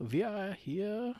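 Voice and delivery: put-on slow, far-off German accent. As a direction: drawl it in a mock German accent. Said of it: "I gotta do something other than German".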